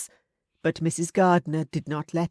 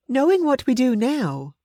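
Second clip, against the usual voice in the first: put-on higher, bright young woman's voice; Elizabeth; 9 semitones higher, 5 dB louder than usual. A narrator's reading. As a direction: voice Elizabeth a little higher and louder.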